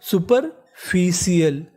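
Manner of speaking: Superficial drawn out slowly, each syllable clear; slow sounding out. Shown as a demonstration. Teacher slowly sounding out superficial for students. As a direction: spell it slowly as you say it. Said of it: "super, F I C I A L".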